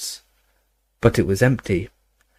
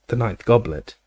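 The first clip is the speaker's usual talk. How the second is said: plain reading voice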